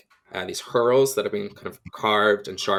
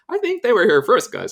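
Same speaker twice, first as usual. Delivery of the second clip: mock protest; 12 semitones higher, 6 dB louder than usual. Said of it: "And I'm like".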